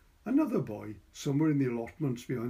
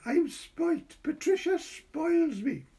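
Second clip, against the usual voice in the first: put-on high, loud child's shout; 4 dB louder, slower than usual. A narrator's reading. Boy shouting gleefully across the park. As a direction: crow it out like a happy boy. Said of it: "he shouted".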